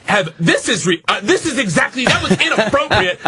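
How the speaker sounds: mock an outraged teacher's telling-off; imitated scolding, voice climbing high. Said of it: "came back to yell at me".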